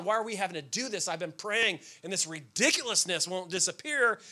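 usual speaking voice

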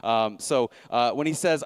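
normal talking voice

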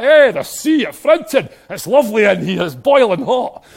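laughing mock Scottish swimmer; Scottish bather. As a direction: boast about the cold sea like a hardy Scot. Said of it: "Only a Scottish man would say that".

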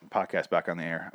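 ordinary delivery

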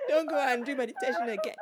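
high, loud laughing scold; laughing mock scold. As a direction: squeal the warning through laughter.

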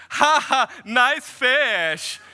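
put-on big laugh in a Borat-like accent; gleeful Borat-sounding foreign English. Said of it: "sounded a little bit like Borat".

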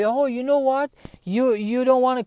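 high-pitched mock indignation; retold huffy comeback, high voice; huffy retort. Retold contractor getting huffy in argument.

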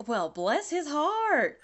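high, drawn-out Southern exclamation. Retold or imitated. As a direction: let a slow Southern accent burst out. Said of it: "Yeah, that's stereotypical".

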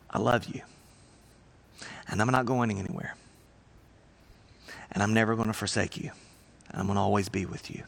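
gentle hushed promise of love; tender reassurance. Acted. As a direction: speak it like comforting someone at a bedside. Jesus' comforting words, voiced by preacher.

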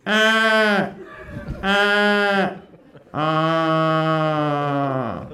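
drawn-out mournful crow caws; crow caw imitation. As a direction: caw like a bored Australian crow. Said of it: "what a crow sounds like in Australia".

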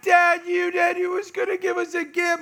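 high, loud wailing complaint; mock crying kids. Children crying to dad over the gift, mock wail. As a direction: imitate children bawling at their dad. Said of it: "They're legitimately crying".

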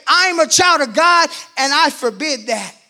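high, loud declaration; preaching shout. Real, not acted. Preacher declaring to the church in a high, loud shout. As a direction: proclaim it with all you've got.

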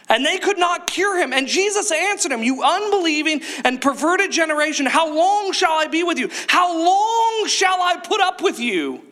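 dramatic preacher's Bible reading, rising to a shout; heightened pulpit reading; scripture reading. Acted. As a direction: read the passage with mounting drama. Preacher reads scripture passage aloud.

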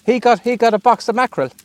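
calling out. Real, not acted. Relaying across to boat.

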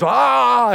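high, drawn-out startled 'aah'; mock fright, held long; imitated startled yell. Homeless man startled awake at the church door, imitated yell.

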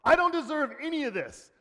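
high-pitched crying out in mock complaint; hammed-up, high-pitched outcry; mock screamed protest. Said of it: "he wasn't screaming, going".

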